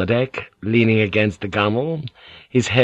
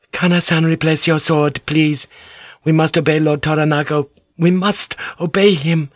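higher, pleading lady's voice; Mariko; 7 semitones higher than usual. A narrator's reading. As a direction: urge him gently but firmly, higher.

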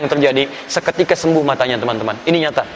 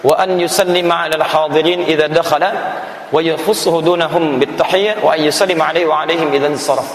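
quick recitation. Real, not acted. Preacher reading a classical text quickly, brisk recitation. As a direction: read the Arabic text briskly. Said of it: "saya baca cepat".

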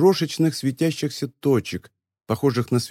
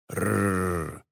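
low, soft rolled growl; quiet growl; 6 semitones lower, 8 dB quieter than usual. A narrator's reading. Giant of flesh answers talk of the lake, quiet growl. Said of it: "тихо прорычал великан из плоти".

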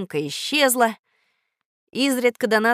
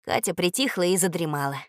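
usual speaking voice

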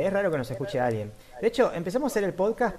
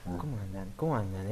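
low, soft seductive murmur; 8 semitones lower, 6 dB quieter than usual. Retold or imitated. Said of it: "esa voz seductora, esa voz que dice".

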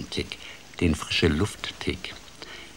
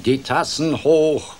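much higher and louder, slow and jubilant; crowing; 8 semitones higher, 6 dB louder than usual. Acted. Frog rallying the party guests, loud jubilant crow. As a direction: raise the glasses, crowing it out. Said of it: "krähte er".

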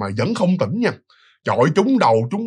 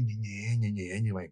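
much softer, barely voiced, like faint breathing; faint breathy murmur; 7 semitones lower, 14 dB quieter than usual. Narrator mimicking the unconscious man's faint breathing, very soft. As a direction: let it fade almost to nothing, mimicking weak breath. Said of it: "vẫn còn thở nhẹ nhẹ".

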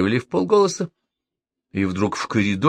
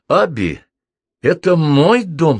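slow, loud, firm declaration; 8 semitones higher, 6 dB louder, slower than usual. A narrator's reading. Host firmly tells his tenant off, slow and loud. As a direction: stand your ground, each word weighed. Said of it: "сказал он твёрдо".

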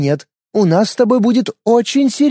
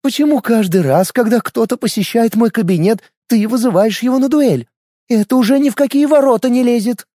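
indignant; 8 semitones higher than usual. A narrator's reading. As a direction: scold her with mounting outrage. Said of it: "возмутилась Светлана Фёдоровна".

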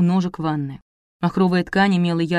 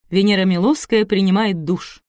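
usual speaking voice

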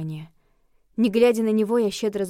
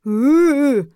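loud, high indignant "eh" groan; wordless outraged zombie bleat; long raised grunt of protest; indignant zombie moan; 6 semitones higher, 10 dB louder than usual. A narrator's reading. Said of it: "возмутился Зигмунд".

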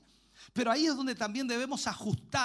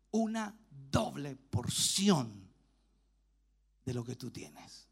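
slow solemn request; 7 semitones lower, much slower than usual. Acted. Elisha asks solemnly.

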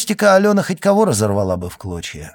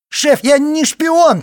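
much higher, louder, slow panicked cry; thin shout; 7 dB louder, slower than usual. Acted. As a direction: shriek it in a thin terrified voice. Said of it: "тонко крикнул Фудин".

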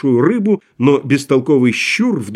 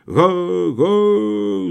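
crowd murmur; 5 semitones higher than usual. A narrator's reading. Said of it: "зашумела толпа".

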